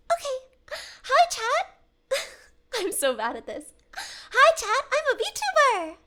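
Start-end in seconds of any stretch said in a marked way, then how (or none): 0.1-2.2 high-pitched cutesy streamer greeting; anime voice. Shown as a demonstration. Streamer retrying anime voice on chat, high greeting. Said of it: "You sounded anime for a second". 4.0-6.0 high, drawn-out cutesy introduction; anime VTuber voice. Shown as a demonstration. Streamer posing as a VTuber to chat, high anime voice. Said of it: "You sounded anime for a second".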